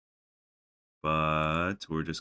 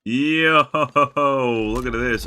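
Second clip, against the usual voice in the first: excited shout; 8 semitones higher, 12 dB louder, slower than usual. Real, not acted. Excited at a game find.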